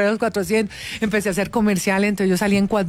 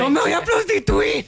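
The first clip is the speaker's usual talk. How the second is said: higher, laughing mock vow to mother; hammed-up laughing promise; mock earnest promise; 7 semitones higher than usual. Daughter jokingly swearing to her mother, high and laughing. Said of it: "Y yo le decía a mi mamá".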